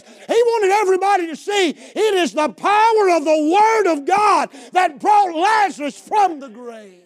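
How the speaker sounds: high, shouted sermon climax; rousing, repetitive pulpit cry; full-force preaching. Real, not acted. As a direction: hammer each phrase out at a shout.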